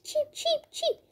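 high whispered cheep cheep; whispered chick cheeps. Pretend. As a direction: breathe out tiny chick peeps. Chick voiced among sleeping animals.

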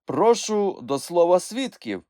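high, loud courtroom voice; judge ruling firmly. A narrator's reading. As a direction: overrule and move the trial on. Judge rules and calls witnesses.

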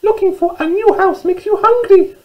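very high, squeaky mouse voice; little mouse. Acted. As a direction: squeak it out like a tiny storybook mouse. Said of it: "said Little Mouse".

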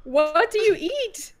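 high, loud, drawn-out disbelief; exasperated, high-pitched astonishment; incredulous. Real, not acted. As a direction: stretch it out in baffled amazement. Incredulous at picky eater.

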